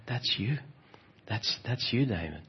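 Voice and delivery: a hushed, understated voice from within; quiet inner voice. Retold or imitated. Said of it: "There was that voice in me".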